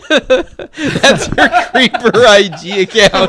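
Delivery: slow words through big laughter; laughing hard. Real, not acted. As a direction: laugh it out loudly.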